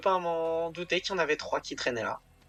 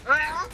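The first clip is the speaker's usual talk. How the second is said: high, loud scream of alarm; sudden frightened cry; panicked yelp; 5 dB louder than usual. Real, not acted.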